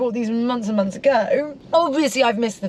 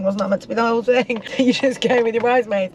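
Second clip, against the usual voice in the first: ordinary talk, nothing marked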